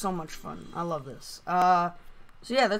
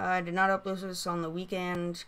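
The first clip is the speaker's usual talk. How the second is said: ordinary talk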